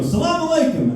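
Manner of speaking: high "Salam alaikum"; smug Arabic greeting. Retold or imitated.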